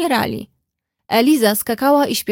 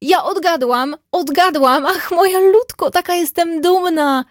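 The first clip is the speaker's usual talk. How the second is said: much higher, joyful and louder; excited cry; 7 semitones higher, 3 dB louder than usual. A narrator's reading. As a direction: burst out with proud delight. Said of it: "zawołała Eliza". (radosny okrzyk, dużo wyżej i głośniej)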